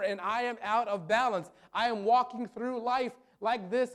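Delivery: loud, high, driving sermon voice; preaching at full force. Real, not acted. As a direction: preach it hard to the whole congregation.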